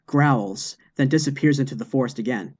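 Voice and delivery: ordinary delivery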